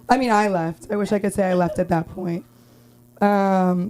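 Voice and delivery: ordinary talk, nothing marked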